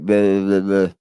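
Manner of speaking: lightly voiced flat droning guy; mock monotone. Streamer mocking the guest's droning delivery. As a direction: mimic a dull droner. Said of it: "he's just talking like this".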